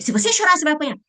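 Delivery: loud, high threat of a spanking; angry parent threatening a crying child. Retold or imitated. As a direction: warn the child sharply.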